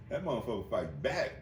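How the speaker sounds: ordinary delivery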